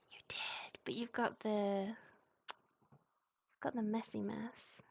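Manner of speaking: hushed mutter; whispering. Real, not acted. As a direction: whisper to yourself. Muttering at drawing mess.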